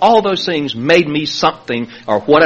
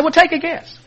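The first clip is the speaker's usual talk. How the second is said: high coaxing counselor; 13 semitones higher than usual. Retold or imitated. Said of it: "She said".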